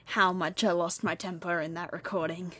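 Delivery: ordinary delivery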